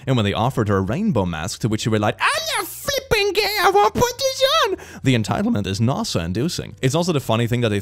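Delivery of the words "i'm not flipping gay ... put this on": high-pitched, indignant quoted rant; outraged Karen. Retold or imitated. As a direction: squeal it in offended disgust. Quoting the woman at the vet.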